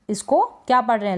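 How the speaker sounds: ordinary talk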